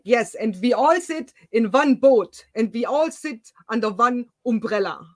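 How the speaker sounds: mock German politician speaking English; exaggerated German-accented English, V for W; Denglish German accent. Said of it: "we call it Denglish, the German English".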